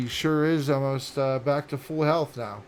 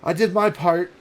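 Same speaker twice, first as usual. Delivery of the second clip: lightly voiced character, raised and louder; wounded comrade; 5 semitones higher, 7 dB louder than usual. Game master as injured fighter to her ally, lightly acted. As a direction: speak up a little, as the character. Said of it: "and she says".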